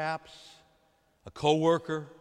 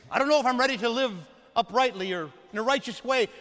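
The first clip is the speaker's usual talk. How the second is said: higher, louder and fast, a hurried nervous excuse; reluctant excuse; 6 semitones higher, 5 dB louder than usual. Acted. Reluctant sinner dodging the Father's invitation, hurried and nervous. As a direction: rush the excuse out, uneasy.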